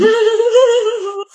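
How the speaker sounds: short mocking laugh at her score. Mocking classmate's low score. (pang-asar na tawa)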